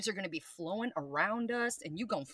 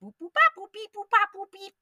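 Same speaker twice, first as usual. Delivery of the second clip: high playful boop beep sounds; beeping noises; 12 semitones higher, 8 dB louder than usual. Shown as a demonstration. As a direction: bounce from task to task in beeps. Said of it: "It's kind of like".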